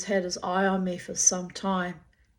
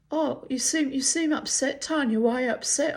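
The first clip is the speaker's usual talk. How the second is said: much higher, sing-song fake sympathy; mocking his fake concern; 7 semitones higher than usual. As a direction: parody a man pretending to care. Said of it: "He went".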